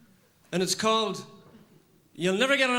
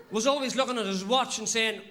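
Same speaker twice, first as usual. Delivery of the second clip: usual speaking voice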